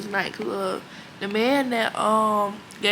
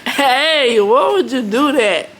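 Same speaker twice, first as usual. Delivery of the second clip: laughing while tickled; squirming, laughing protest; being tickled; 4 semitones higher, 9 dB louder, slower than usual. Real, not acted. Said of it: "Stop tickling me".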